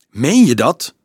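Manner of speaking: loud, high, startled question; shrill. A narrator's reading. Dolf reacting to the claim of fake monks, shrill. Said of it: "vroeg Dolf schril".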